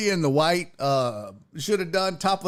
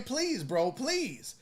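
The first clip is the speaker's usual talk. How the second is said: soft, calm "please, bro"; 9 dB quieter, much slower than usual. Shown as a demonstration. Teaching self-defense, demos a soft calm plea with hands out. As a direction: hold him off gently. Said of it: "all you gotta do is just be like".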